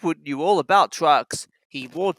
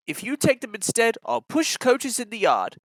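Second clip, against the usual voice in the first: lightly raised kind little engine voice; a friendly offer, a touch higher; Edward, kind engine; 5 semitones higher than usual. A narrator's reading. Kind engine offering to help Thomas, friendly.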